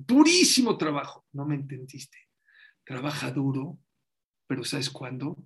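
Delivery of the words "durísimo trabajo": loud, high protest on "Durísimo"; insistent worker. Retold or imitated. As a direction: insist hard, offended. Man insists he works hard. (trabajador protestando fuerte)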